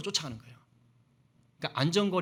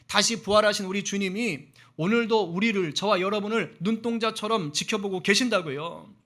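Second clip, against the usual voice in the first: raised, insistent sermon voice; fervent preaching; 4 semitones higher, 4 dB louder than usual. Real, not acted. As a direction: exhort and reassure the congregation with force. Preacher exhorts congregation. (힘 있게 선포하는 설교조)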